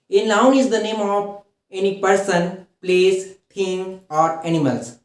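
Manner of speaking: slow, word by word dictation; dictation pace. Shown as a demonstration. Teacher dictates the noun definition to students, very slowly. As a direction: spell it out slowly for notes.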